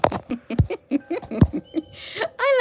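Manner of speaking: long high-pitched giggle; tickled, squeaky laughter. Real, not acted. Laughs at pet's reaction.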